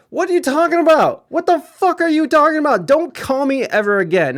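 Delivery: high, fast, feigned outrage at the caller. Retold or imitated. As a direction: act shocked he'd even ask, then hang up. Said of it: "acted phone call".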